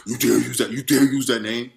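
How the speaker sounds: mild mock roar of a furious devil; imitating the devil's intimidating yell; roaring demon voice. Shown as a demonstration. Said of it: "a roaring, yelling, a very intimidating, like, voice".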